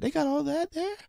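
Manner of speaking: high, soft naive wonder; self-mocking high, quiet voice; ignorant questioner. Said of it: "my ignorance right here".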